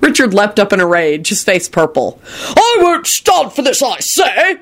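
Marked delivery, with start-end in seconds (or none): 2.6-4.6 high-pitched outraged shouting; enraged scream. A narrator's reading. Jealous husband leaping up at the table, screamed. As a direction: scream it in blustering fury. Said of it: "he screamed".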